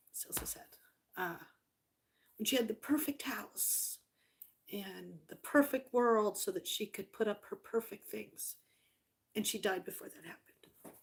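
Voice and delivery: hushed, sorrowful near-whisper; real sadness. Real, not acted. Daughter recalling her late mom's unfulfilled wish, hushed and sad. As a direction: let the voice drop away with grief.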